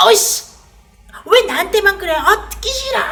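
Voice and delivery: loud, high, hammed-up angry shout; angry outburst. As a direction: yell it like a sulky child who won't listen. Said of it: "그러면서 사람들은 더 화를 내는 거예요". (짜증 섞인 과장된 고함)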